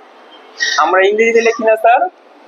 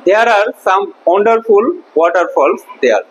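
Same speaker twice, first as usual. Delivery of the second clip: slow, deliberate model sentence; slow reading; much slower than usual. Shown as a demonstration. Teacher reading the textbook line slowly to the class. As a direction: spell it out slowly for the pupils. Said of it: "teacher reads sentence slowly".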